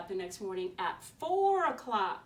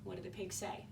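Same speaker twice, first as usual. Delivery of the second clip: usual speaking voice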